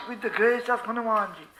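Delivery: normal talking voice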